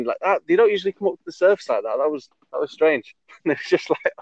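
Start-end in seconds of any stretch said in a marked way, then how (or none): 0.2-3.1 higher, quicker imitation of the guide; retold local guide's voice; local boatman. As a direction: mimic a puzzled boatman, pitched up. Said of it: "I can't do the accent again".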